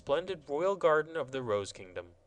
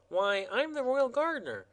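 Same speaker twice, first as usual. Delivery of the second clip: high, prim gardener explaining; self-important gardener rules; gardener's high voice, earnest; fussy royal gardener; 11 semitones higher than usual. Acted. Gardener voice held.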